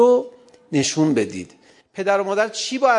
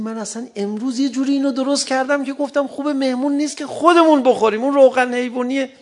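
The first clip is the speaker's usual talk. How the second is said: imitating a housewife; 8 semitones higher, 3 dB louder than usual. Imitating a proud housewife cook.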